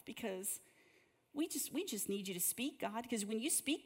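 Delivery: soft, hushed prayer to God; heartfelt prayer. Real, not acted. Pastor praying softly for the room before the break. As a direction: pray quietly over the room. Pastor prays aloud to close session.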